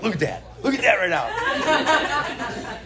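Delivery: high, slow, laughing mockery; teasing kid. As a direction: drag it out, pointing and cracking up. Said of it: "and Hayden's like".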